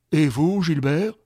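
loud, stern courtroom judge; judge's question. Acted. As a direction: boom the question across the court. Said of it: "le président des assises demanda".

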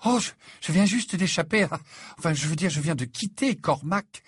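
slightly high, stumbling explanation; flustered. Acted. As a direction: stammer a little, correcting yourself. Flustered Hermione explains her escape.

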